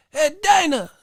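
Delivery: very high mock Cuban accent; Yoel Romero. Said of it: "Remember when Yoel Romero said".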